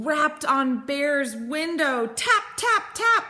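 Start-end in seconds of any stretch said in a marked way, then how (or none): 2.0-3.2 high, crisp tap sounds; tapping sound word. Acted. Mole knocking on Bear's window, high and crisp. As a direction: tap out each word like knuckles on glass.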